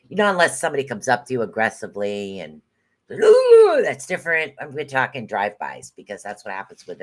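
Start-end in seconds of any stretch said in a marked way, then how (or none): 3.1-3.8 sudden high startled "Ooh"; a hammed-up startled yelp. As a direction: jump back as if someone got in your face. Mock reaction to aggressive person.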